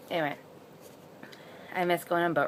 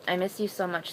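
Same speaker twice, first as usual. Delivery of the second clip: ordinary talk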